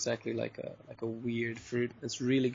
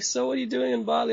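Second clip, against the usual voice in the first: high, quick guest's question; curious guest; 12 semitones higher, 5 dB louder, faster than usual. Retold or imitated. Hostel guest quizzing the owner, a high, quick curious question. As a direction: rattle it off like a curious backpacker.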